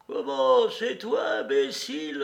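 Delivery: high, loud indignant retort; scornful outburst. A narrator's reading. Woman at window recognizes Courrapier, scornful. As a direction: throw the insult back in disbelief. Woman insults him back in quarrel.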